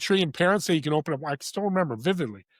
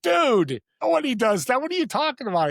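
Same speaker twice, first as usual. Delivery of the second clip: high, loud, stunned surfer-dude voice; 10 semitones higher, 4 dB louder than usual. Retold or imitated. The grocery clerk is stunned someone pays for a stranger, surfer dude. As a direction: play the amazed Northern California surfer clerk. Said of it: "He's the surfer dude. He's like".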